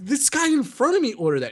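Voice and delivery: high-pitched retold protest; high, indignant self-defence. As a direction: protest your innocence way up high. Retold protest against false accusation.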